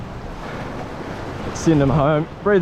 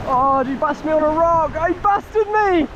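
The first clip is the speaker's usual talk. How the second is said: dismayed cry; 7 semitones higher than usual. Real, not acted. Fish breaks line on rock.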